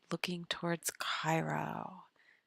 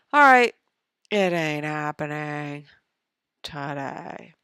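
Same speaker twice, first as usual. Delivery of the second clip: loud resignation; 2 semitones lower, 11 dB louder than usual. Real, not acted. Loud giving up.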